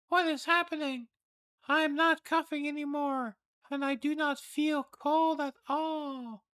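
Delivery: put-on high, loud, amazed girl's voice; a frail girl suddenly well; astonished young girl. Reads sick girl's lines.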